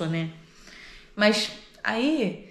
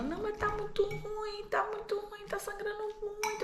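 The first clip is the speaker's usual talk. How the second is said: mock childish whimpering; whining child; 14 semitones higher, 8 dB quieter than usual. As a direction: imitate an inconsolable hurt child. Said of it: "só sei que piorou".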